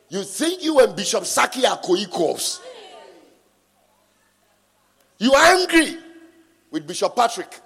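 loud, high, hammering warning to the flock; preaching at full force. Acted. Preacher warning a member who feels equal to Bishop Dag, loud fiery preaching. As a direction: drive every word home like a fiery preacher.

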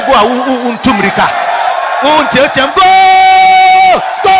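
long, drawn-out goal shout; acted football cheer. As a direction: cheer like a fan at a match. Acts out football fans shouting goal.

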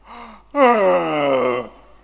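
a big, loud, drawn-out demo yawn; exaggerated yawn. Shown as a demonstration. Preacher demonstrating a rude yawn in church, loud yawn. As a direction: show the congregation a bored yawn. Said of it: "that's how you yawn".

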